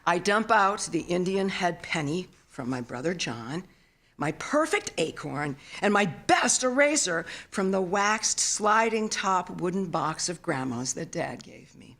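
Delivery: usual speaking voice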